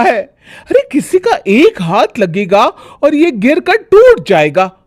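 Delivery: high, agitated fault-finding voice; fussy bahu. Acted. Bhabhi warning mother-in-law about the bowl, fussy. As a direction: point it out anxiously.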